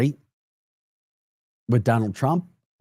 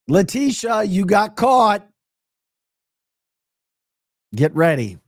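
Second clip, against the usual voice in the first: taunting; 9 semitones higher, 5 dB louder than usual. Acted. Taunting her directly.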